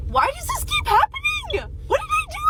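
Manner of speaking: high, cracking plea of frustration; pained whine. Real, not acted. Venting to viewers about dating, high pained whine. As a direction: wail it up high like she can't take it.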